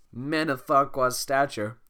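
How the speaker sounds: slow deadpan joke. Acted. Shrek's joke.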